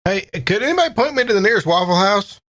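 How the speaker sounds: usual speaking voice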